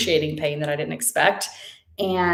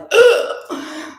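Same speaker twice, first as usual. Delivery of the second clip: a loud high startled gasp; sharp high gasp; 9 dB louder than usual. Retold or imitated. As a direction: gasp as the truth hits. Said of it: "And I was like".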